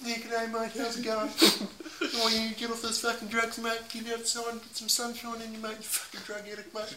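high, soft Aussie character voice; sassy high-pitched Australian; Sassy the Sasquatch. Acted. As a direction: scold a mate in high Aussie tones. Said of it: "Sassy the Sasquatch Aussie".

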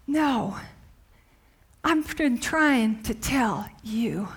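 Jesus gently correcting the disciples, soft and slow; gentle reply. Retold or imitated. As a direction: answer quietly and tenderly. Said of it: "Jesus is saying to them".

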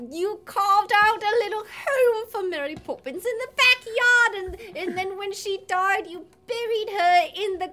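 put-on rambling theatrical child voice; lightly voiced earnest child. Child reminding mother about Mary Poppins, mock-earnest pleading.

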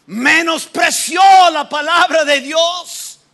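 slow, high, shouted; outraged declaration. Real, not acted. Preacher condemning contempt for God's word, slow and shouting. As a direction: hammer each word out.

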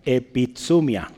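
Epizumia drawn out slowly for note-taking; slow pronunciation. Shown as a demonstration. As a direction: say Epizumia slowly so they can write it. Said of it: "lo puede anotar".

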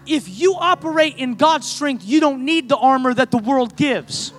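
loud, high preacher's build; fervent revival cry; preaching at full force. Acted. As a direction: drive it home to the congregation.

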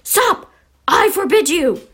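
high, frantic command; alarmed, high-pitched order; panicked yell. A narrator's reading. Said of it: "yelled Uncle Vernon in panic".

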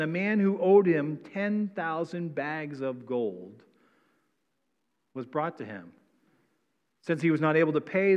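formal public reading of scripture. Acted. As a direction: read the Bible text out with weight. Pastor reads scripture passage aloud.

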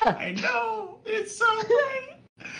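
very high, slow happy wail; excited anime fan. Pretend. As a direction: squeal through fake happy tears. Crying happily after senpai remark.

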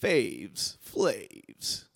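faves then flaves, low and soft; tongue-twisted. Shown as a demonstration. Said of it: "Wait, what?".

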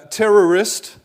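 ordinary talk, nothing marked

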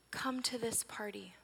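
soft, slow whispered plea, "Come to this party"; gentle plea. Acted. Mother softly begging her angry daughter to come in. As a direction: whisper the invitation tenderly and slowly. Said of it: "I don't accuse her or yell".

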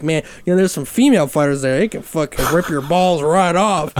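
lightly voiced Southern hillbilly; a hint of a rambling Southern uncle; Southern hillbilly accent. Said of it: "like, Cletus from, uh, The Simpsons".

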